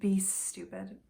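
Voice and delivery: usual speaking voice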